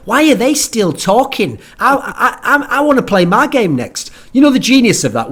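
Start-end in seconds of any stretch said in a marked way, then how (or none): 0.1-4.1 high, slow, impatient gamer whine; mock impatient pro player; whiny player. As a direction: whine like a bored player who wants to play. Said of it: "The players go".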